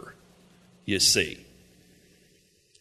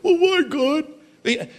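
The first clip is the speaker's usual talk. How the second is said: high, slow, drawn-out whimper to God; mock whining; 12 semitones higher, much slower than usual. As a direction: stretch it like a sulking child. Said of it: "we do our own thing".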